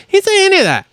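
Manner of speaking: high-pitched exasperated rebuttal; indignant protest. Real, not acted. Podcast host defending a rider in a rant, indignant. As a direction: burst out in high, indignant disbelief.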